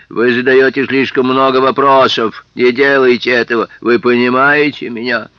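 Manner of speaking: loud, hard warning; menacing threat. Acted. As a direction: threaten him loudly. Policeman threatening.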